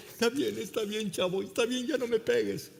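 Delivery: high, thin-volume cowed voice; beaten bully surrendering. Retold or imitated. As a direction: beg off like someone just beaten. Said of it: "Todo sangrado del rostro, el otro".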